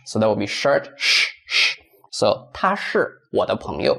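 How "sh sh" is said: SH as a long voiceless hush; extended SH. Shown as a demonstration. Teacher isolating the first part of shirt, long SH. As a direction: draw out SH, SH with no voice. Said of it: "extend S H".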